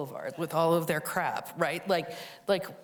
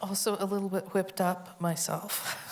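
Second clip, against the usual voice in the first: normal talking voice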